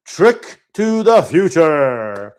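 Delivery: loud, slow, dramatic title reveal; announcer flourish. Pretend. Host revealing an upcoming game, mock announcer flourish. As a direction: belt out the title, stretching the last word.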